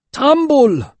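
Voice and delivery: loud bellowing call. A narrator's reading. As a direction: bellow the name across the village. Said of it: "Father was bellowing now".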